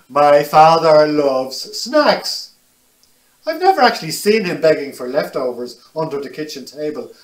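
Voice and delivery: put-on lively, animated read to children; picture book reading. A narrator's reading. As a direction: tell it brightly to little listeners.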